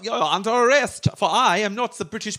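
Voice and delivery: high-pitched mock German accent. German spy arresting the English person, high German accent. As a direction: announce it in a hammed-up German accent. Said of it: "it was a German person pretending to be British".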